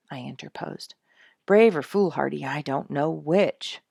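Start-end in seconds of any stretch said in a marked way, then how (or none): 1.5-3.8 moaning. A narrator's reading. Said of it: "Dick moaned".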